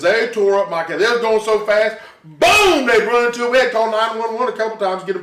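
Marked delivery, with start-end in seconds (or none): 2.2-2.9 shouted crash sound, pitched high; loud boom. Retold or imitated. Father acting out his kids' scooter crash, loud boom. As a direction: blurt out the crash like an impact.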